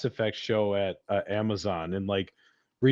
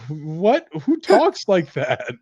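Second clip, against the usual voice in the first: incredulous; 6 semitones higher, 5 dB louder, slower than usual. Retold or imitated. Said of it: "it's like".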